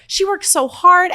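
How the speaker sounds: high, slow mock-doting mom voice; a hint of a proud mom; imitating mom. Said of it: "God bless her".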